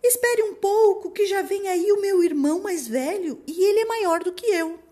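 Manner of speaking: very high loud little goat; tiny goat voice. Acted. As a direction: plead high and small. Smallest goat keeps pleading. (vozinha fina de cabritinho)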